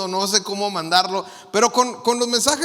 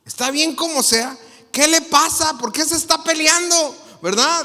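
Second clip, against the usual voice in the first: mock-outraged reader, rising higher and louder; indignant; 7 semitones higher, 4 dB louder than usual. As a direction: build from incredulous echo to loud protest. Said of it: "se ve que está enojado". (indignado, alzando la voz)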